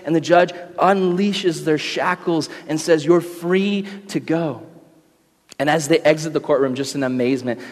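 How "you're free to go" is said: gentle release. Acted. Judge releases the convicts.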